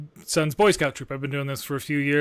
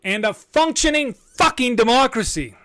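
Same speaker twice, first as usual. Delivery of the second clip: higher and louder, spitting out the swear; angry outburst; 8 semitones higher, 5 dB louder than usual. Real, not acted. Host ranting to listeners about lost democracy, angry outburst. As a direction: let the anger burst through.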